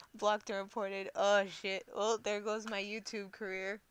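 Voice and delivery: ordinary talk, nothing marked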